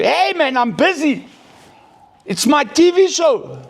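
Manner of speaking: high mock mum brushing the child off; imitated annoyed mother. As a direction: shoo the kid away, irritated and mocking. Mother snapping at her child.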